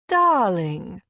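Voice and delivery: loud trilling mother in cut-glass English; grand cut-glass greeting down the phone; cut-glass English accent. A narrator's reading. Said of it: "my mother's cut glass accent is trilling".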